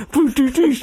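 high comic "boo, doo, doo"; imitated pigeon pecking. Telling a friend how pigeons eat, imitated pecking. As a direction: mimic a bird's quick pecks in sound. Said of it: "then they just follow after and go".

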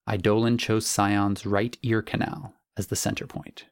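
plain reading voice

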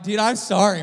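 high, loud, exclaimed apology; loud mock apology. Preacher acts out apologizing to his hurt brother, loudly. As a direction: blurt it out loudly to your brother.

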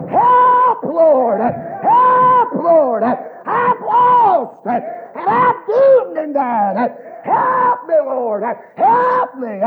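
high, loud wailing shout; desperate cry. Retold or imitated. Preacher re-enacting his cry on the day he got saved, wailing shout. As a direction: holler each plea from the soul, long and high. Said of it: "I remember crying out for my soul".

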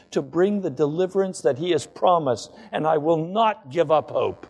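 normal talking voice

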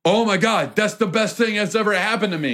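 high, gushing fan voice; overjoyed fan. Retold or imitated. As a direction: squeal it like the best day ever.

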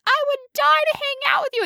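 high, starstruck fan voice; a mock swooning fan; gushing fan. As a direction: gush like an awestruck groupie. Fans gushing over the famous.

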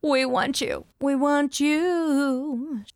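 high, drawn-out coaxing; playful pleading. Pretend. Host wooing a listener to come on the show, playful pleading. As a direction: coax them, stretching the last YOU out. Coaxing a hoped-for guest on air.

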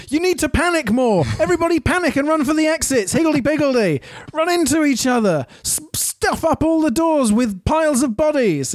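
high, hammed-up urgent announcement; mock panic orders. Retelling characters urging a crowd to panic, mock frantic orders. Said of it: "Asher and Theo arrive and said".

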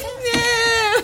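high bleating goat cry. Retold or imitated. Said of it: "and it goes".

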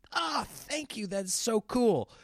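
high, drawn-out, touched gratitude; gushing thanks. Retold or imitated. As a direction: melt with thanks, stretching the words. Acts out grateful reply to fans.

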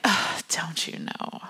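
slow, whispered aloof scoff; haughty whisper. Pretend. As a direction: breathe it out like a grand lady snubbing a critic. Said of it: "I can see Phryne being like".

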